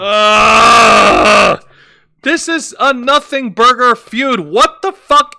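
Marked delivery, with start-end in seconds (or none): 0.0-1.6 frustrated groan. Real, not acted. Exasperated groan at the feud.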